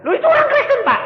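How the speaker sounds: loud, very high-pitched outcry; mock indignant protest. Complainer objects to pastor about Christians, loud shrieking protest. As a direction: protest at the top of the voice.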